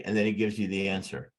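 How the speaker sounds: ordinary talk, nothing marked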